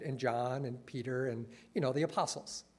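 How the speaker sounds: ordinary delivery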